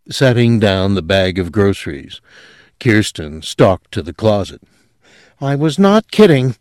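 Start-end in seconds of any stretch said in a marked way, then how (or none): none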